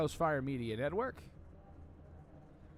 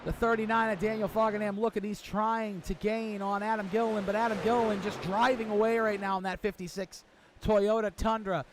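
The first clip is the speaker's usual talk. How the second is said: fast, louder, higher race call; live play-by-play; 4 semitones higher, 4 dB louder than usual. Acted. Commentator calling the opening laps to viewers, excited play-by-play. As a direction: call the action as it unfolds.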